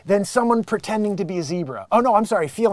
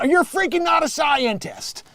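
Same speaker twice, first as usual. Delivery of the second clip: indignant; 9 semitones higher than usual. Real, not acted. Retort to impersonated claim.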